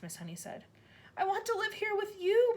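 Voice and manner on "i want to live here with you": put-on high begging girl; pleading child. A narrator's reading. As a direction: beg with all her heart. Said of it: "Matilda cried out".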